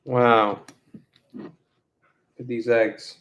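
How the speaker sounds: usual speaking voice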